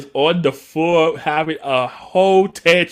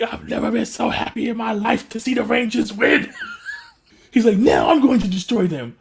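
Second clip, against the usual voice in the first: higher, giddy voice through laughter; laughing villain; 9 semitones higher than usual. Retold or imitated. Zed overjoyed at the Rangers' win, laughing high. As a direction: gloat gleefully while laughing.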